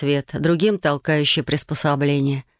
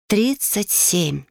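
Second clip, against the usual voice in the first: drawn-out, higher-pitched amazement; amazed; 4 semitones higher, much slower than usual. A narrator's reading. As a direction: stretch it out in wonder. Amazed count of summoned spirits.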